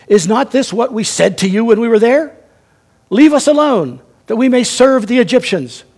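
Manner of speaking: loud, high indignant complaint; complaining people. Acted. Frightened Israelites complaining loudly to Moses. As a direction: hurl the accusation at Moses.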